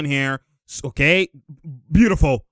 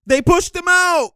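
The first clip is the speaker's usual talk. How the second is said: high, drawn-out yell at the play; real heated shout; excited outcry; 13 semitones higher, 4 dB louder than usual. Real, not acted. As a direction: cry out in protest, high and slow. Live reaction to a pushed player.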